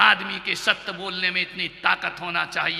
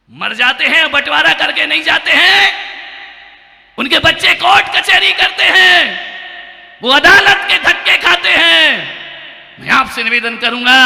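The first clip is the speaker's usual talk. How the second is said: higher, louder warning cry; alarmed shout; 9 semitones higher, 8 dB louder than usual. Real, not acted. Monk warns families about court fights, shouting. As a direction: shake them awake about wills.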